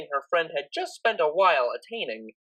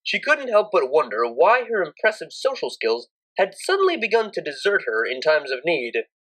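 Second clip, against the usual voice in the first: ordinary delivery, nothing marked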